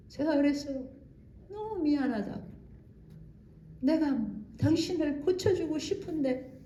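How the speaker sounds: usual speaking voice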